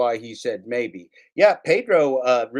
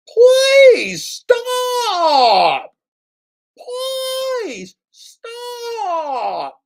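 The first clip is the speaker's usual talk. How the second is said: shrieking, crying plea, far higher and louder; John Billings impression; 8 dB louder, much slower than usual. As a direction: wail "please stop" like a desperate, sobbing man. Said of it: "my impression of John Billings".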